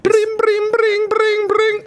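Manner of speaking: very high trilled ringing; imitated phone ring. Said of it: "Bluetooth call comes in".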